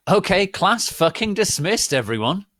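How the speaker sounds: high, loud brash announcement; loud dismissal. Acted. Teacher loudly dismissing the class. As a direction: bark it to the whole class. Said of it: "Professor Trevorley said".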